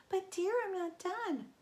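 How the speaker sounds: coy and nervous, playing along; Grover in falsetto. A narrator's reading.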